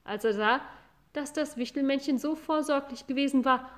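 ordinary delivery

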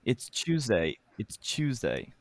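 "Tuesday" starting like "chew", said carefully. Shown as a demonstration. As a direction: copy the British "chew" sound in "Tuesday". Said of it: "you've gotta say it like chew".

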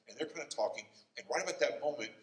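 usual speaking voice

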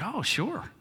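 a touch of surprised delight; bright surprise. Acted. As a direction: chirp a quick eager yes. Waitress agrees to see shoes.

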